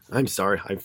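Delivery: ordinary talk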